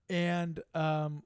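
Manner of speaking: normal talking voice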